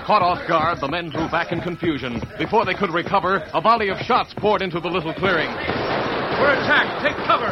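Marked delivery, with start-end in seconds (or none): 5.5-7.5 yelled high and very slow, in alarm; panicked shout. Acted. Outlaw yelling to the gang under fire, panicked shout. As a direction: bellow a warning to the gang. Ambush in the clearing.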